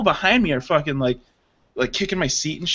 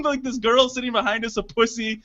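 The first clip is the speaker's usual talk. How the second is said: normal talking voice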